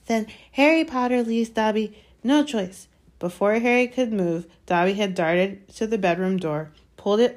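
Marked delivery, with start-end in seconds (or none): 0.0-2.9 high pleading house-elf; squeaky house-elf. Acted. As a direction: plead like a nervous little elf. Dobby's resolute threat.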